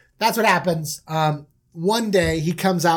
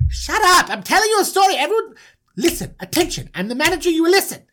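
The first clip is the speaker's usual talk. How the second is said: high, indignant scolding; bossy manager; 10 semitones higher, 3 dB louder, faster than usual. Acted. Manager silences doubting brothers mid-story, high and imperious. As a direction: demand attention, pitched way up. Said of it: "And he's like".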